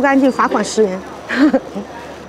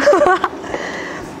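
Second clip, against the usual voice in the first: breathy giggle; 8 semitones higher, 4 dB quieter than usual. Real, not acted. Laughs after joking to fans.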